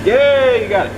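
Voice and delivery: loud, bright cheer of praise; cheering yay. Real, not acted. Dad cheering the boy who got the bolt loose, loud happy yay. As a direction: cheer him on as he gets it. Son finally frees the bolt.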